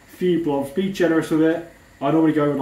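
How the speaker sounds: usual speaking voice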